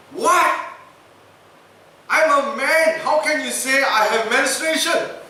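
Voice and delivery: high, loud indignant yell; outraged shout. Retold or imitated. Governor shouting at the doctor over the diagnosis, outraged. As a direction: bellow your disbelief.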